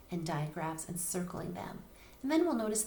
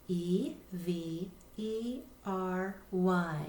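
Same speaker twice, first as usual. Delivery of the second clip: slow, letter by letter; teacher spelling a word aloud; much slower than usual. Shown as a demonstration. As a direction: spell each letter slowly and clearly. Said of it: "spelling letter by letter".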